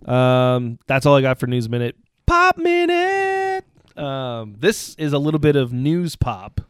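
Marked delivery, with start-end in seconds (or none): 2.2-3.7 drawn-out jingle call, high; segment announcer. Acted. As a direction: sing out the segment title.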